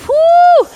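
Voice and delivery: high, loud cheering whoo; excited whoo. Real, not acted. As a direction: cheer them on with a big whoo. Coach cheering during workout.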